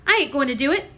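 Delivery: high, loud stubborn refusal; defiant servant girl. A narrator's reading. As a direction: dig in and refuse.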